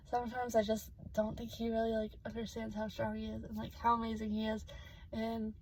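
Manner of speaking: soft, fading voice, about to cry; close to tears. Real, not acted. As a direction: say it very softly, holding back tears. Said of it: "I'm gonna start crying again".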